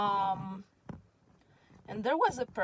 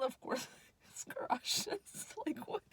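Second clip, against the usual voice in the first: laughing; 8 semitones higher, 4 dB quieter, slower than usual. Real, not acted. Retelling the joke that cracked her up.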